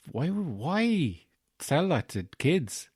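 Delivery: high, slow, drawn-out pleading why; hammed-up high-pitched questioning, dragged out; mock dismay. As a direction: wail it in exaggerated disbelief. Mock reaction to film character.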